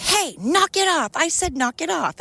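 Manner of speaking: high mock-mom scold, knock it off; imitating a scolding mom. Mom scolding a pestering puppy-like kid, high mock scold. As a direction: play a mom telling the kid to stop. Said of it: "a mom being like".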